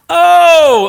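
high, very loud "oh"; hammed-up Jersey shout; Italian New Jersey holler. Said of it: "like I'm Italian or something".

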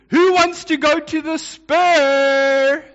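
put-on high, slow sing-song kid asking; child's voice. Daughter asking siblings in the car, high and slow. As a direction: voice a little girl's eager question, stretched out.

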